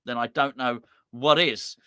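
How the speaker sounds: normal talking voice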